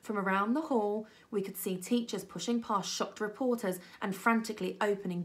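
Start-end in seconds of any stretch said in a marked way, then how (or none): none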